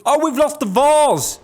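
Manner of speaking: high, loud cry of alarm; shocked dismay. Real, not acted. Police chief losing an officer, shocked loud cry. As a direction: yelp at the sudden loss.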